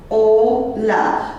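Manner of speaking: "hola" split into two slow syllables, o - la; rozdzielone sylaby. Shown as a demonstration. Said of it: "would divide the word".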